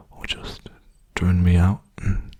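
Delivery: ordinary talk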